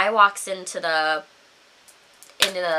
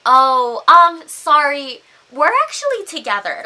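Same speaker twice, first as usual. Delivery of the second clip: louder, hesitant mock apology; awkward brush-off; 4 semitones higher, 7 dB louder than usual. Paige fends off the stranger, awkwardly apologetic. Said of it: "And Paige is like".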